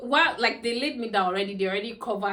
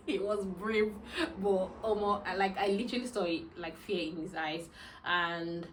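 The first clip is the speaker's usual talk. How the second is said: ordinary talk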